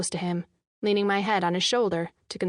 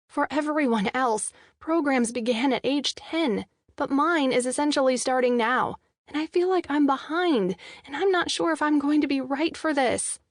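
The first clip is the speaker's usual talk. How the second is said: nerves spilling out in one breath; anxious rambling; 6 semitones higher than usual. A narrator's reading. Heroine confessing her worries to her boyfriend, anxious higher rambling. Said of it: "Rambling will not convince him".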